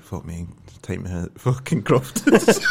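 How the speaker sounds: ordinary talk, nothing marked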